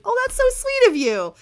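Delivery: very high, loud squeal of delight; gushing delight. Acted. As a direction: melt over the sweet news. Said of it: "she's so pleased by what she's seeing".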